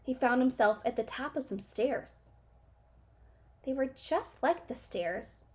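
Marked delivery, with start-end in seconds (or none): none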